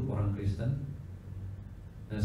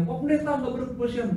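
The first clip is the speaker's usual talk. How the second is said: retold shout; 13 semitones higher than usual. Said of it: "Diteriakin seorang jemaat dia".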